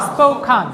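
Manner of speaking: স্পোকান with an open "kan" ending; pronunciation model. Shown as a demonstration. Teacher modelling pronunciation for students, স্পোকান ending in "-kan". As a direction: model স্পোকান clearly, second syllable "kaan". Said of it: "উচ্চারণ করে".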